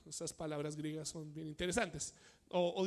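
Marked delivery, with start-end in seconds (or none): none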